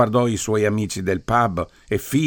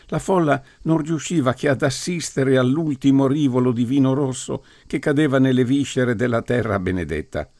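usual speaking voice

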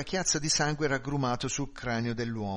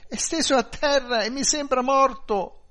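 high, loud, anguished wail; sobbing outcry; 13 semitones higher, 10 dB louder than usual. A narrator's reading. Wife describes the body to police, wailing loud and high. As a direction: cry it out down the phone, pitched way up. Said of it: "singhiozzò lei".

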